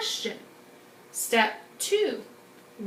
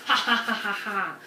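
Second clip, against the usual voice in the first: hammed-up villain cackle; evil scientist laugh; 3 semitones lower than usual. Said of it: "we're like an evil scientist experimenting".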